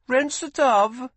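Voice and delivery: high, slow, foreign-sounding greeting; foreign girl's greeting. A narrator's reading. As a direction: say it like a local girl in her own tongue. Girl speaks foreign tongue.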